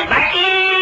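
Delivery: high street call of the milkman; milkman's call. Retold or imitated. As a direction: sing out the word like a vendor at dawn. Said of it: "બૂમ પાડી".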